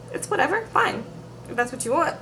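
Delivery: high, sulky brush-off in a put-on voice; lightly mocking imitation of a sulking woman giving in; mock resigned Darcy. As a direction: toss it off like a hurt girlfriend, pitched high. Mimicking Darcy's resigned reply.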